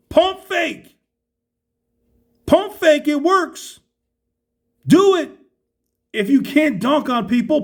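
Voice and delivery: high, fired-up coaching rant; halftime pep speech. Real, not acted. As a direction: urge them on like a coach. Coaching players through the screen.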